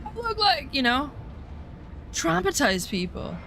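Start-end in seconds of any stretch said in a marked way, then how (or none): 0.0-0.7 high, mocking voice of shocked dismay; pitched-up mock disgust; 1 mock horror. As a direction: squeal it like you just saw yourself bald. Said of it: "and they're all bald and be like".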